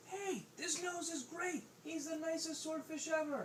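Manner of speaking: high, drawn-out praise; slow, high-pitched acclaim; admiring sea creatures. Acted. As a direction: announce it in a high cartoon voice. Sea creatures cheer the swordfish.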